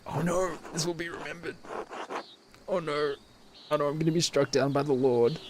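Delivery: high-pitched pretend panic; hammed-up dismay, pitched well above normal; mock dread. Player fearing the Lord after a game choice, mock dread.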